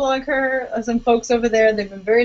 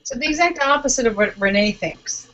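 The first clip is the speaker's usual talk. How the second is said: ordinary talk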